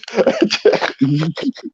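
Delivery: high, soft laugh. Real, not acted. Laughing at a streamer's punchlines, real laugh.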